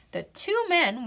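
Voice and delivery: usual speaking voice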